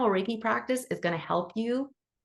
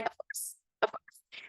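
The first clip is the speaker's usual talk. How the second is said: breathless little whisper of eager agreement; 9 dB quieter, slower than usual. Pretend. Playing Bard, whispering eager yeses to the user. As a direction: hush it like a delighted secret.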